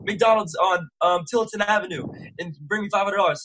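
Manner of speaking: put-on a scared kid calling his mom in tears; retold crying plea. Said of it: "So I FaceTime my mom crying".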